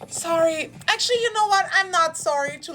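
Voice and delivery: high, loud, sarcastic "Sorry" then a defiant retraction; sassy fake apology. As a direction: play the newly bossy woman who refuses to apologize. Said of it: "you become a bitch, you're like".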